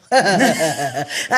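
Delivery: short real laugh; a quick amused laugh. Real, not acted. Laughing at her own joke, a short real laugh.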